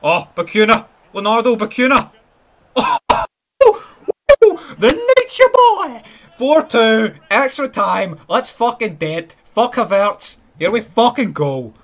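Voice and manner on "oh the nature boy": wailed high and loud; high crying shout. Real, not acted. Gamer wailing at the opposing scorer, high and loud. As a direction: cry it out like a wrestling announcer. Cheering an opponent's goal sarcastically.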